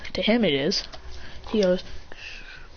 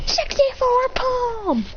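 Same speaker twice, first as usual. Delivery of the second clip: very high, drawn-out attack call; acted anime finisher, pitched way up; battle cry; much slower than usual. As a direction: stretch out the finishing move. Final attack name.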